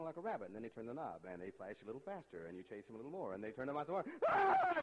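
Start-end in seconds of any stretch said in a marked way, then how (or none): 4.3-4.8 sudden high loud yelp of panic; panicked yelp. Retold or imitated. Student overwhelmed at the reading machine, loud panicked yelp. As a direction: yelp as the words fly past. Panic at speeding flash screen.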